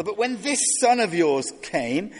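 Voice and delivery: high, loud indignant complaint; resentful older brother. Retold or imitated. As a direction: voice the older son's bitter outrage. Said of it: "He says to the father".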